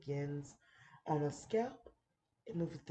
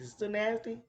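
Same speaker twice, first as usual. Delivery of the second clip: ordinary talk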